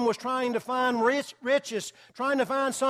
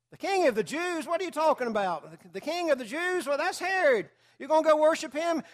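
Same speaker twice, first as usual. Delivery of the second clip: mock disbelieving neighbor, pitched higher; incredulous neighbor; 6 semitones higher than usual. Neighbor scoffing at wise men packing camels, mock incredulous. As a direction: scoff like a baffled busybody next door. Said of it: "The neighbor says".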